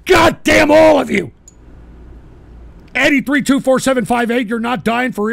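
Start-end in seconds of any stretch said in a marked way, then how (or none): 0.0-1.3 loud, high-pitched curse; angry shout. Real, not acted. Host curses his audience, angry shouting. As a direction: shout it at the whole crowd.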